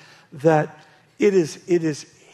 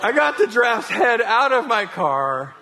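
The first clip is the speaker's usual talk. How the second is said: can barely keep a straight face; preacher cracking up at the giraffe tale; laughing through story; 7 semitones higher than usual. Retold or imitated. Retelling absurd giraffe story.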